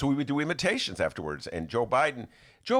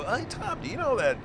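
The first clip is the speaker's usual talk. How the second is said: higher, eager; bright call to Tom; retold call; 9 semitones higher than usual. Host retells tipping off Tom, higher and eager. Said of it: "and I go".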